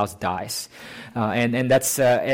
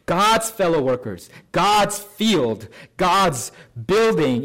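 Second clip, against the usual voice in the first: each God's lifted higher and leaned on, falling after; stress on God's; 3 semitones higher, slower than usual. Shown as a demonstration. Preacher drives home God's ownership to the congregation, God's stressed. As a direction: lean hard on God's each time. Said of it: "So the emphasis on God's possession".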